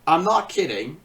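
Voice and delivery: usual speaking voice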